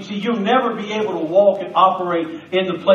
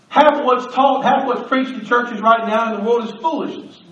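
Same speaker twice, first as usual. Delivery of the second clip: ordinary talk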